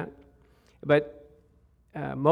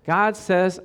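usual speaking voice

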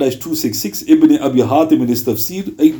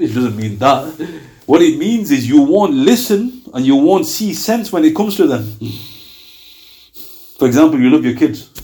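ordinary talk, nothing marked

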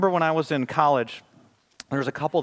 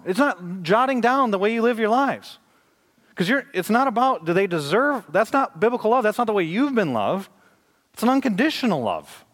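higher, louder rising sermon voice; impassioned preaching; 8 semitones higher, 3 dB louder than usual. Acted. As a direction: preach it with building fire. Preacher at full force.

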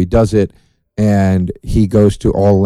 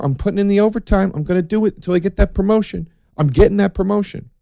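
higher-pitched, indignant self-pep-talk; worked-up jealous employee; 7 semitones higher than usual. Acted. Jealous worker vowing to get promoted, indignant and determined. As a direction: play someone passed over, working themselves up.

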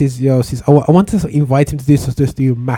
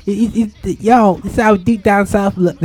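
high mock Cockney; stumbling put-on London patter; fake London accent; 5 semitones higher than usual. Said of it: "it's my fake London accent".

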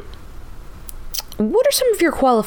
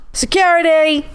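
calling out; 4 semitones higher than usual. Acted. Calling for a guard.

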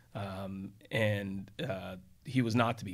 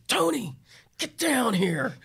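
much higher and louder, a commanding call; loud high order barked into the phone; judge's booming summons; 13 semitones higher, 7 dB louder, slower than usual. Retold or imitated. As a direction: bellow down the phone like a furious judge.

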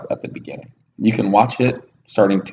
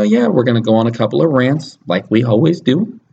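usual speaking voice

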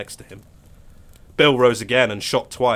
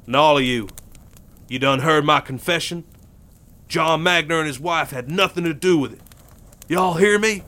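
louder, raised voice to the crowd; calling out; 7 semitones higher, 5 dB louder than usual. Acted. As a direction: make every hand hear the confession. Bill calls out to field hands.

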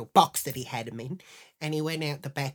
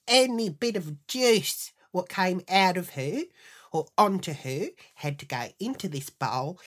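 ordinary delivery

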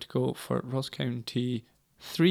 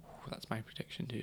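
unvoiced whispered aside; whispering; 3 semitones lower, 18 dB quieter than usual. Real, not acted. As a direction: breathe it out in a whisper.

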